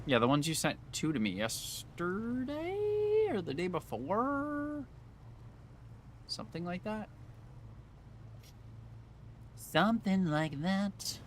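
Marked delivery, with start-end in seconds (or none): 3.5-11.2 high slow singsong; silly high voice. Pretend. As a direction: draw it out high and goofy. High drawn-out goofy voice.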